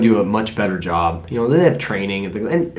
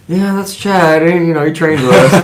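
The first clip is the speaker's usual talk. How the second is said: high, louder, amused awkwardness; mock sheepish explanation; 7 semitones higher, 6 dB louder than usual. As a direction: act out excusing a teammate to your parents. Awkwardly introducing swearing teammate to parents.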